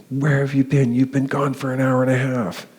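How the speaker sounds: high-pitched mock yell of an upset wife; retold scream. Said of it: "she screams at me".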